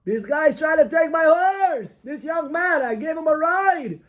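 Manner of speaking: high, drawn-out mock cries; screaming for help. Old man accusing his rider of theft, screaming for help. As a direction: wail it out high like a wronged victim. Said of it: "the old man starts to scream".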